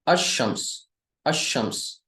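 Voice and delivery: अशम्स with a doubled sh and no l; अशम्स with a held sh sound; Arabic pronunciation. Shown as a demonstration. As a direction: say अशम्स, lam silent, shin geminated. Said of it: "ये लाम silent हो गया".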